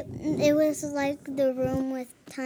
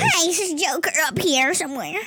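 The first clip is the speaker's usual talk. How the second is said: louder, fast mock Joker; 4 semitones higher, 5 dB louder, faster than usual. Shown as a demonstration. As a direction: rattle it off like the Joker. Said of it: "Is that your Joker".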